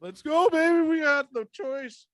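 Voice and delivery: high, loud thrilled cheer; excited cheering. Real, not acted. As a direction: cheer it out with delight. Fan cheering the draft pick.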